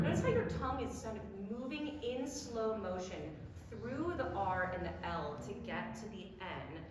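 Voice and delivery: normal talking voice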